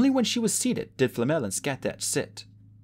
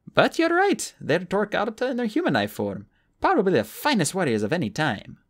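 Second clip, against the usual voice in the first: much higher, louder warrior woman's voice; Skatach's voice; 7 semitones higher, 4 dB louder than usual. Acted. Skatach agreeing with Sophie over dinner, in a high loud voice. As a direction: speak up briskly, a confident fighter.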